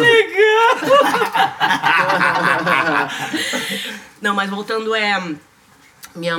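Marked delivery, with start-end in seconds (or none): 0.8-4.2 loud laugh through tears; loud teary laugh. Real, not acted. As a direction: laugh off the emotion. Emotional laugh after near tears. (risada chorosa e alta)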